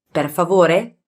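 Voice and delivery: ordinary delivery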